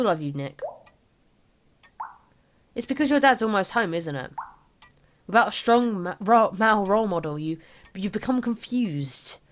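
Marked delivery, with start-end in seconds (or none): none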